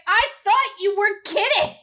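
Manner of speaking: indignant. Retold or imitated. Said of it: "gets really indignant and is just like".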